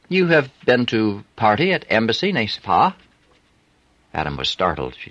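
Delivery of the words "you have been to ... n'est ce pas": put-on higher voice with a French accent; a flirty French streetwalker. A narrator's reading. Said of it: "unmistakable French accent".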